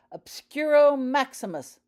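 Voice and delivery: booming, high commanding spell; loud commanding. A narrator's reading. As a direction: command the house to seal. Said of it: "in a loud, commanding voice".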